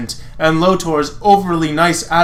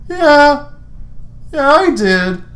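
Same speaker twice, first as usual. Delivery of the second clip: high, deflated admission; sheepish Lance; 12 semitones higher, 4 dB louder, slower than usual. Acted. Lance admitting to Hunk he messed up, high and sheepish. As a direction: own up in a high voice.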